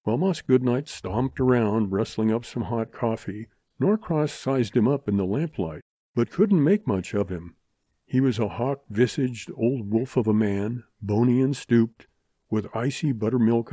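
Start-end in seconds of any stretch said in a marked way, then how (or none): none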